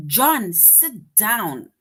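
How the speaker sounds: very slow, loud stern order; stern command. Shown as a demonstration. Teacher reading an order to John, slow and stern. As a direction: tell John firmly to sit.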